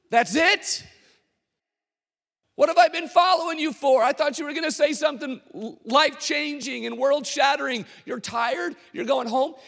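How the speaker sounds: high, loud indignant disbelief; disbelieving outrage. Retold or imitated. Crowd to Forrest after he goes home, outraged. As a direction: let it burst out, betrayed.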